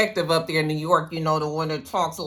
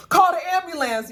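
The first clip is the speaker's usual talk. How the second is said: high, urgent cry for help; calling out; 12 semitones higher, 3 dB louder than usual. Retold or imitated. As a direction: shout it up high for an ambulance.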